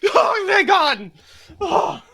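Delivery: high, loud, drawn-out gasp of relief; loud theatrical relief; huge relief. Acted. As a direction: burst out as if finally released. Character freed from paralysis.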